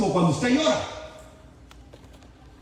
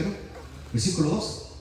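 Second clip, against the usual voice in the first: usual speaking voice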